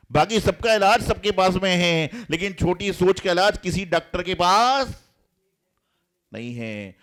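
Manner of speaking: loud, high sermon emphasis; preacher in full flow; forceful preaching. Acted. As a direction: drive the point home to the crowd.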